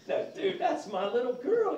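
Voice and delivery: high, loud no way dude; mock outraged dad; protective outburst. Real, not acted. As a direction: bark it like a protective father.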